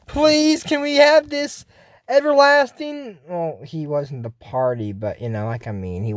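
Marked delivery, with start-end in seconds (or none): 0.1-3.2 pleading. Real, not acted. Begs show for wish.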